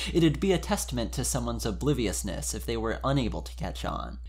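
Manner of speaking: ordinary talk, nothing marked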